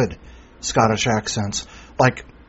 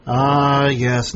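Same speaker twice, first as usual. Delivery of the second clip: normal talking voice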